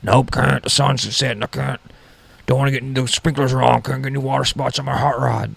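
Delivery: put-on a fussy old guy barging into the garage; crazy old guy. Old roommate interrupting to park his car, fussy put-on voice. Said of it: "I live with this crazy old guy".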